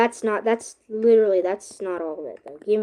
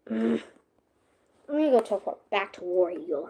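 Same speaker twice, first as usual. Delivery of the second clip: ordinary delivery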